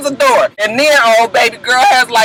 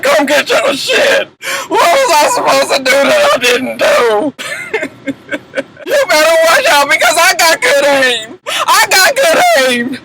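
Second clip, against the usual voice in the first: guttural cry; 5 semitones higher than usual. Retold or imitated. Baby Knees throwing his stuff off the balcony, guttural crying yell. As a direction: howl it through a throaty cry. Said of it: "all this real guttural cry".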